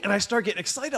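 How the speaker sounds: ordinary talk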